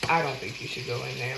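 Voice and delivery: low, soft, calm warning. Retold or imitated. Retelling a stranger's warning at the club, very calm. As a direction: quote him calmly, pitched well down. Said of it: "in a very calm voice".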